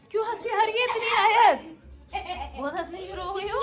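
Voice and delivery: cooing through laughter. Real, not acted. Mother cooing at giggling baby.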